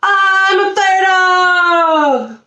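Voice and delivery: high, long-drawn gleeful shout; barked cry. A narrator's reading. Puppy riding on a fire truck, high drawn-out excited cry. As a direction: stretch every word out high like a proud pup. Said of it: "Lucky barked".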